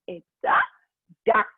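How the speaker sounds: ordinary delivery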